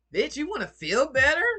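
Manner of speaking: mock indignant. Mocking offended unhealed people.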